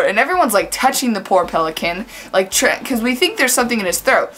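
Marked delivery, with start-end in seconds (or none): none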